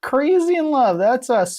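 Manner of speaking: very high, loud chirpy read; giddy Mabel. Acted. Mabel declaring the three are in love, high giddy voice.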